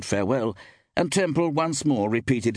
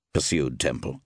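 usual speaking voice

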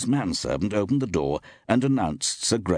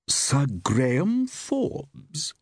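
slow, high, drawn-out query; querying actress; 7 semitones higher, much slower than usual. A narrator's reading. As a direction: stretch the name out high, as a theatrical question. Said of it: "queried Iris".

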